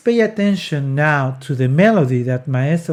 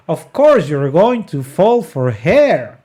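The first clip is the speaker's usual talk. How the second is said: ordinary talk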